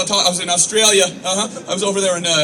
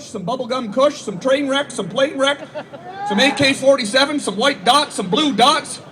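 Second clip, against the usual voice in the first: higher, cheery listing voice; casual stoner request; 9 semitones higher, 4 dB louder than usual. Retold or imitated. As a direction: rattle off strains like a grocery list. Couple's shopping list dialogue.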